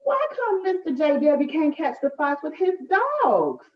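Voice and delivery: put-on high, curious young Flossie; little girl asking. A narrator's reading. Flossie asking Big Mama about the fox, childlike. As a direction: ask it like an eager child. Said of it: "Flossie asked".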